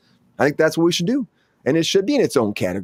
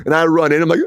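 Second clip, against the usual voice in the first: usual speaking voice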